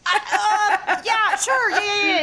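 high, flustered yeses; hasty agreement. Acted. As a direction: agree to anything just to move on. Hasty agreement to get help.

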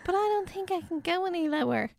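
squeaky high imitation; high mock Emma. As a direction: quote her up high. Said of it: "And Emma was like".

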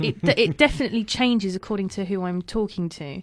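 ordinary talk, nothing marked

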